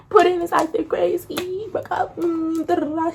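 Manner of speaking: high-pitched whiny complaint; mock whining. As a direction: whine it out to a coworker. Re-enacts upset complaint to coworker.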